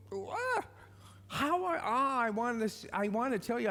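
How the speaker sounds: imitating his own flustered self; flustered surprise. The speaker retelling his shock at meeting the bully, high and stammering.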